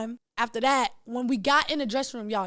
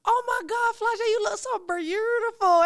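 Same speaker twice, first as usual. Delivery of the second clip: put-on high, gushing Memphis accent; 10 semitones higher, 4 dB louder than usual. Rapper greeting her on the red carpet, high Memphis-accent gushing. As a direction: imitate a rapper's excited Memphis drawl, drawn out. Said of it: "She said it in her Memphis accent".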